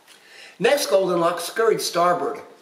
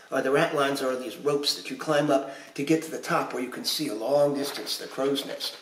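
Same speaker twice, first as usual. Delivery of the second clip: usual speaking voice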